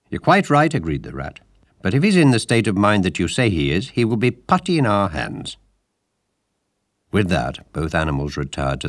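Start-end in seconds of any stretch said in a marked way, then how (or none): 0.1-5.6 high, loud, assured agreement; confident Rat. A narrator's reading. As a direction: sound sure of the plan.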